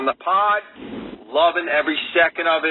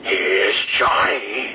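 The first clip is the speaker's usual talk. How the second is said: low, slow menacing "Here's Johnny"; Shining impression; 7 semitones lower, much slower than usual. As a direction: drag it out like a creepy movie villain. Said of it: "You ever see The Shining?".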